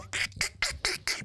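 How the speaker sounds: voiceless wheezing laugh; mom's imitated laugh. Son showing how his mom laughs, voiceless wheeze. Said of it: "My mom laughs like that".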